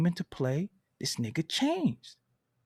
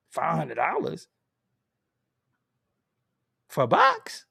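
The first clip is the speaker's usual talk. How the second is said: incredulous squeak. Retold or imitated. Mocking hotel price.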